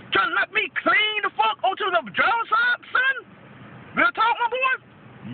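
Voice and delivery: high, rising challenge to a driver; indignant confrontation. Retold or imitated. Confronting the truck driver who cut in, outraged.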